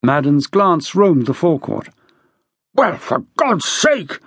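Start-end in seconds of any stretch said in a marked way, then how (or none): 2.7-4.2 slow, high exasperated outburst; fuming. A narrator's reading. General to inspector about the delay, fuming. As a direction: stretch each word in fuming impatience. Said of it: "Raikes fumed".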